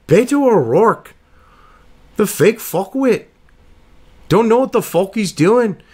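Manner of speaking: high, lilting fake Irish brogue; mock him in a bad Irish accent; hammed-up Irish accent, swooping pitch. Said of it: "I gotta practice my Irish accent".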